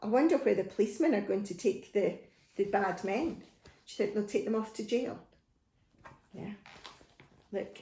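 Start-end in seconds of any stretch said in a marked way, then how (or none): none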